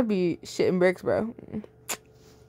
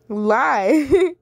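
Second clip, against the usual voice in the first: plain reading voice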